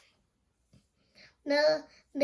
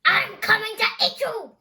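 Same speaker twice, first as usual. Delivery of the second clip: higher, louder troll threat; a hungry troll lunging for its meal; menacing troll; 7 semitones higher, 7 dB louder than usual. Acted. Troll reaches out to grab the goat, loud and menacing.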